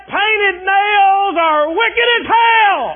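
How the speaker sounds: slow, high shouted preacher impression; hammed-up hellfire preacher shout; mocking a fire-and-brimstone preacher. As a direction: bellow it like an old-time preacher condemning sin. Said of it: "he was preaching one time".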